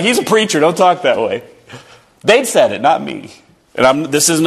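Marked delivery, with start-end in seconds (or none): 0.0-1.4 higher, mock-scolding coworker; lightly voiced veteran shushing others; coworker warning. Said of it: "They're like".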